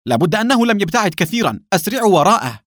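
high, loud, urgent order; urgent shout. A narrator's reading. Villain sends his men after the fugitive, shouting. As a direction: shout orders to give chase. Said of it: "ثم صاح في الرجال".

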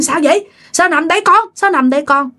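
put-on a frightened mother checking on her child; worried mother. Mother finding her child on the floor, anxious questions.

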